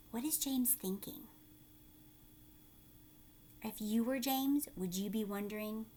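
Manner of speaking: usual speaking voice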